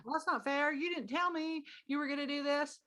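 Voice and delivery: put-on indignant child voice; protesting child. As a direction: object like an aggrieved kid, voice lifted high. Child protesting a missed breakfast.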